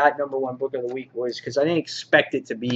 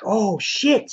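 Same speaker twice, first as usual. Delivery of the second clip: higher-pitched mock dismay; mock shocked curse; 8 semitones higher than usual. Reviewer voices the hero stranded on the moon, mock dismay.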